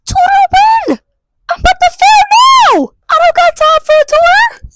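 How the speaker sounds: high, loud indignant yell; outraged shout at the tutoring offer. Retold or imitated. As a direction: shriek it in disbelief. Outraged reply to the teacher.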